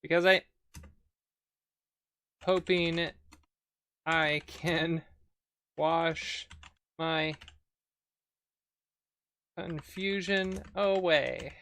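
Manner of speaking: slow, word-by-word dictation with gaps; halting, spaced-out typing voice; dictating while typing. Real, not acted. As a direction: read out each word as you type it. Types commands aloud.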